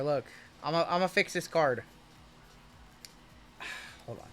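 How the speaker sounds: normal talking voice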